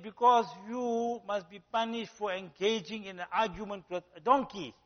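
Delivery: the lion king rules on the horse, mock-solemn; lion's verdict. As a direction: hand down the judgment like a king. Lion gives the punchline verdict.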